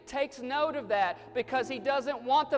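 high, loud, driving sermon delivery; full-force preaching. Acted. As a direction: hammer each repeated phrase at the congregation.

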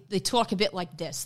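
lightly put-on Brooklyn accent. Shown as a demonstration. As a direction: slip into a Brooklyn voice. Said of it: "I had to do a Brooklyn accent".

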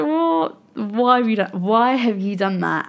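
replaying an exasperated inner voice; exasperated self-reproach. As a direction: scold yourself in mock disbelief. Retelling her self-reproach after texting.

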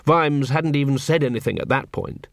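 ordinary delivery

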